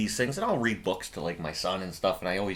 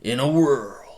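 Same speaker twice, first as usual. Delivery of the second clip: loud, drawn-out trailer announcer; hammed-up trailer narrator; movie trailer voiceover; 4 semitones higher, 7 dB louder, slower than usual. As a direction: boom it out like an epic trailer. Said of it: "start doing movie trailer, uh, voiceovers".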